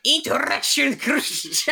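high, drawn-out mock German-Spanish outburst; angry German-Spanish accent. Host showing the angry combination, hammed-up German-Spanish accent. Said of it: "But also angrily and loud".

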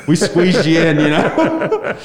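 laughing through the words; giggling while talking. Real, not acted. Joking about tiny crowd.

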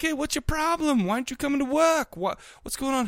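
high-pitched mock boss; hammed-up workplace telling-off; nagging boss. Boss confronting worker in retelling.

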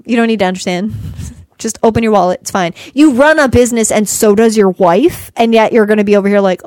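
ordinary delivery

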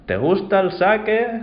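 put-on a little old man calls out to the drunk; high slow elderly voice asking about sake; old man calling. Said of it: "Le vuelve a preguntar".

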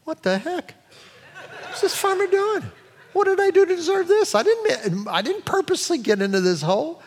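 high-pitched, baffled mule voice rising to a yelp; bewildered mule. Retold or imitated. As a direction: squeak out the mule's shock at the dirt. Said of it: "He's like".

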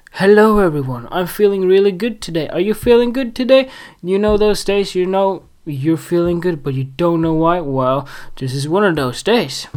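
usual speaking voice

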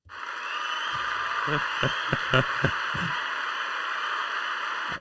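breathy laugh at a word. Real, not acted. Laughs at his skipped word.

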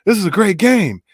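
delighted, laughing over a great game; gushing fan. Retold or imitated. Fan praising the game to friends, laughing excitedly. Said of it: "It's like".

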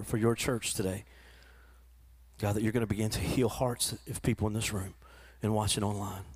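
low, soft pastor's prayer. Real, not acted. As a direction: pray quietly and earnestly before the church. Pastor leads closing prayer.

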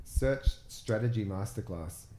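slow, clear dictation to a phone; repeating to voice assistant. Real, not acted. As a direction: spell it out slowly for Siri.

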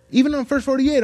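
ordinary talk

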